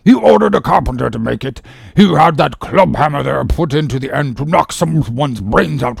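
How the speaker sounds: loud, high pompous doctor; self-important grievance, building in force; complaining surgeon. Acted. Surgeon showing Ahab his dented skull, pompous complaint.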